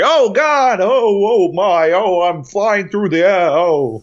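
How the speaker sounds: hammed-up high, loud wailing; Benedict Cumberbatch. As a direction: cry out in drawn-out mock panic, accent gone. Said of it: "Anytime he raised his voice".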